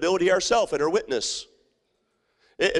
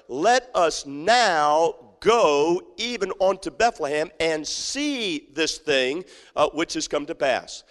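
slow, reverent reading; slower than usual. Acted. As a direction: draw out the shepherds' words. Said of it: "The shepherds said one to another".

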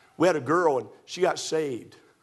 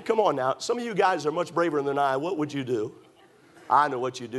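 ordinary talk

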